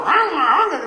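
high, loud "ah" cries; imitated yelling. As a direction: holler comically as birds come at you. Acts out yelling among attacking birds.

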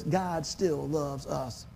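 slow and soft, each word laid down; a slow, quiet assurance that God still loves us; slow emphasis. Real, not acted. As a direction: let every word land slowly.

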